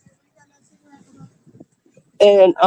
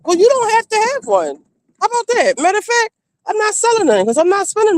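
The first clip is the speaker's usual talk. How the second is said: higher, indignant comeback; exasperated retort; 11 semitones higher than usual. Retold or imitated. She answers cadgers offering a dollar, pitched-up indignant retort. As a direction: snap back at the cheapskate, pitched up. Refusing cheap cigarette buyers.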